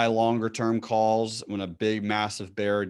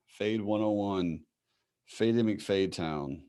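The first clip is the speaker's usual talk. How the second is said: ordinary talk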